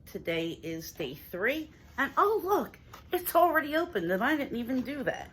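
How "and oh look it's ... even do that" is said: high, bright surprise at the box; surprised. Real, not acted. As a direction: sound suddenly taken aback. Finds box already opened.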